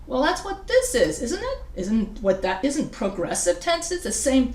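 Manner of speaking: questioning student. Retold or imitated. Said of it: "everybody's asking".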